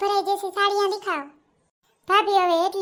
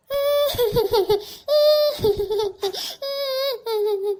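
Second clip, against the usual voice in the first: high wailing laugh that breaks into crying; 6 semitones higher than usual. Acted. Bride teased by sister-in-law, wailing laugh-cry.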